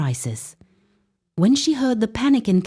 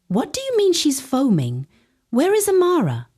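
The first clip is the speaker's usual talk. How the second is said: alarmed; 8 semitones higher than usual. Acted. Alarmed father.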